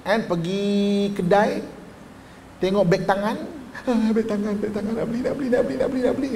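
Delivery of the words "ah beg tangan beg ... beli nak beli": put-on childish pleading whine, pitched up; whining nafsu. Inner desire begging to buy the handbag, childish whine. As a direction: beg like a spoiled kid at the shop. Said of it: "Dia merengek".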